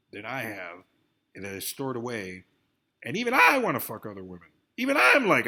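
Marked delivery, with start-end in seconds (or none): 3.0-5.5 loud and fast, heated rant; loud heated outburst. Real, not acted. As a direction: blurt it out loudly, worked up. Heated rant about actresses.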